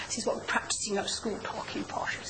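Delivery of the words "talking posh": "talking posh" whispered in a put-on posh voice; a teasing hint of posh English; mock posh. Shown as a demonstration. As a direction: breathe "talking posh" like a Queen's English toff.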